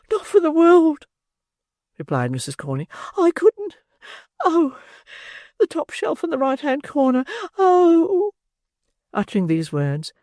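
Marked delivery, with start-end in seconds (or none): 0.1-8.3 distracted matron. Matron frantically pointing the beadle to the cupboard. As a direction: gasp it out in a frenzy.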